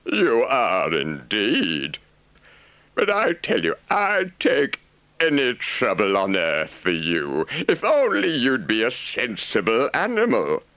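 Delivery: honest but fond; blunt caring Rat. A narrator's reading.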